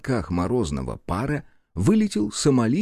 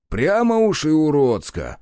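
offended objection; 6 semitones higher, 4 dB louder than usual. A narrator's reading. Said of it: "обиженно возразил Рух".

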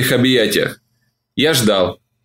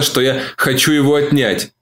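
plain reading voice

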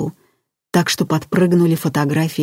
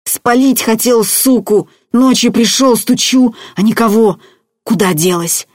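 high, loud, rattled complaint; whining, worked-up outburst; agitated babbling; 6 semitones higher, 5 dB louder than usual. A narrator's reading. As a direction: babble on like a distraught simpleton. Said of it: "бубнил Петька".

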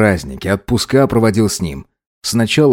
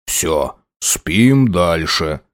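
sleepy murmur; 5 semitones lower, much slower than usual. A narrator's reading. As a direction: hush the kid back to sleep. Father settles child to sleep.

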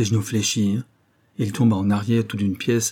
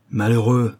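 a touch louder cry of dismay; exclaiming; 4 dB louder than usual. A narrator's reading. Croisenois, stung at being called a coward, crying out. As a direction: fling the word out as a cry. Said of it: "s'écria Croisenois".

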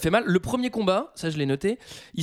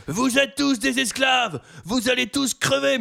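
higher, louder and slow, a hammed-up rallying yell; mock speech shout; 8 semitones higher, 3 dB louder, slower than usual. Proximo's speech to new slaves, mock shouted. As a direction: bellow it at the slaves like a ringmaster. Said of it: "il va leur faire un méga speech".

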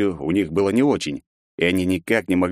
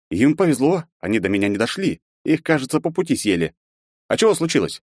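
put-on higher, offhand young man; lightly voiced carefree hero; 6 semitones higher than usual. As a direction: shrug it off breezily. Hero shrugs off danger.

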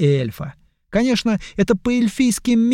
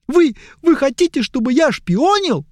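put-on high, pleading young elf; whiny elf; 10 semitones higher, 4 dB louder than usual. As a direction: beg high and tearful.

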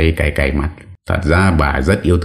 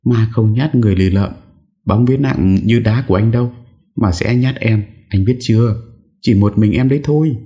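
put-on higher, worried young wife arguing; fretful wife voice; 7 semitones higher than usual. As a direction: warn him, scared and insistent. (giọng vợ lo sợ cãi chồng, cao giọng)

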